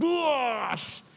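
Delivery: high, booming imitation of breaking glass; second crashing noise. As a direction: explode with a drawn-out smash sound. (tiruan bunyi pecah menggelegar)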